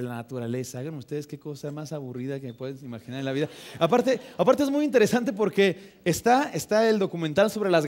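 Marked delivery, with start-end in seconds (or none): none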